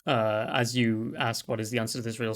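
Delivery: ordinary talk, nothing marked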